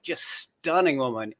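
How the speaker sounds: normal talking voice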